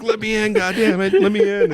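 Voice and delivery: high-pitched yell, rising to a squeal; mock screaming. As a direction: imitate a man screaming to be let in. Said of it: "Chuck's still screaming".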